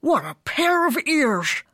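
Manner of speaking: high, drawn-out doggy exclamation; dog music teacher. A narrator's reading. Music teacher admires the pup, high exclaiming.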